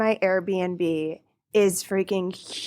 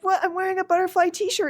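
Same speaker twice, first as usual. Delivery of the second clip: startled, higher and quicker; 11 semitones higher, faster than usual. Retold or imitated. Retold startled reaction.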